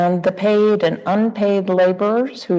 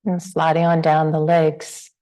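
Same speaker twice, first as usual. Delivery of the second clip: unhurried meditation guidance with long pauses; guided meditation. Real, not acted. Teacher guiding the group to relax into the body, guided meditation. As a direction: ease listeners gently through a body scan, leaving space between phrases.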